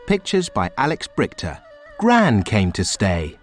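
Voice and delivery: ordinary delivery, nothing marked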